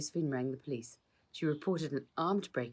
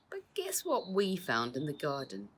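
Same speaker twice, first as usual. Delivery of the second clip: whispered taunt; 2 semitones higher than usual. Acted. Crook taunting.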